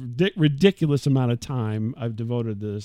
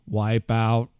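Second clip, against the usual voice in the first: robot voice; 5 semitones lower, slower than usual. Retold or imitated. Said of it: "unless I did it in a robot voice".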